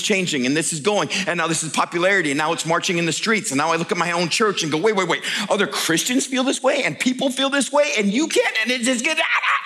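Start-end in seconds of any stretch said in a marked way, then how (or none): 4.8-9.6 exasperated, sputtering into broken noises; building frustration. Acted. Looking at his own church in disbelief, building frustration. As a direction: climb higher until words fail.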